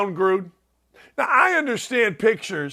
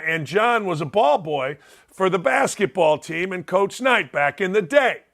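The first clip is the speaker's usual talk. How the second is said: ordinary talk, nothing marked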